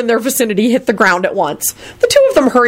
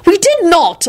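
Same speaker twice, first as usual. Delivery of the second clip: high, offended denial; outraged high-pitched protest; indignant screech; 12 semitones higher, slower than usual. A narrator's reading. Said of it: "Elizabeth screeched".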